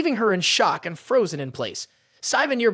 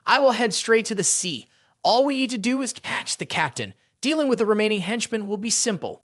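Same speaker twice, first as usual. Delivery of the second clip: plain reading voice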